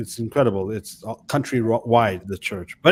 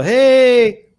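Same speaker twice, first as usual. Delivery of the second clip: mock cheery greeting, much higher and louder; fake friendly hey; 11 semitones higher, 9 dB louder than usual. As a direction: put on a fake-nice "Hey". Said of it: "some of them will put on the".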